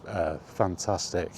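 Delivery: ordinary talk, nothing marked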